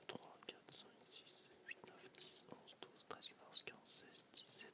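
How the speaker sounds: 低声耳语数数; whispered counting. Real, not acted. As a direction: whisper the count under your breath. Counting points under breath.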